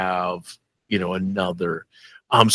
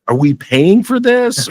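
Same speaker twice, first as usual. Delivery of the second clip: mock incredulous; 12 semitones higher, 4 dB louder than usual. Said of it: "I was like".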